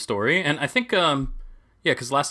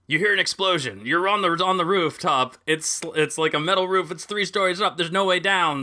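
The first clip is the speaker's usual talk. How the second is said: higher, dramatic scene setting; game master narration; 5 semitones higher than usual. Acted. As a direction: set the scene like a DM.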